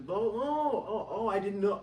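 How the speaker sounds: high oh oh of discovery; hammed-up I didn't know; mock realization. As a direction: imitate sudden dawning surprise. Mimicking people realizing.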